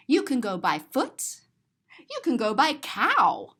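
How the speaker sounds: usual speaking voice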